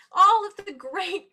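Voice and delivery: usual speaking voice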